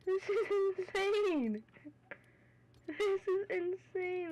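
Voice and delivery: high, slow amazed exclaims; thrilled disbelief. Real, not acted. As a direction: stretch it out in awe. Reward reveal.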